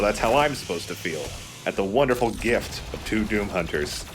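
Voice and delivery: ordinary talk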